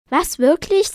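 high, loud burst of excitement; thrilled disbelief. A narrator's reading. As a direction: blurt it out, amazed and eager. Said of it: "Er sah aus, als würde". (aufgeregt und ungläubig)

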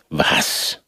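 breathy frightened gasp; startled gasp. A narrator's reading. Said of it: "rief der Hasenfuß ... heftig erschrocken".

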